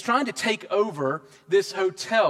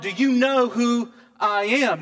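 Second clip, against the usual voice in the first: slow, higher-pitched indignant challenge; mock scream; 4 semitones higher, 4 dB louder, slower than usual. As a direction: imitate his scream without fully yelling, drawing out each word. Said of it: "when he screams out".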